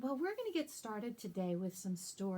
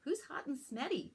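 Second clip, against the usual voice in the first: sister voice; 6 semitones higher than usual. Acted.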